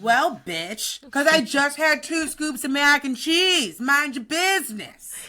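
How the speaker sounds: loud, high, drawn-out indignant comeback; mock sassy clapback. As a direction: fire back slowly and loudly, acting fed up. Imagined retort to food-pushing relative.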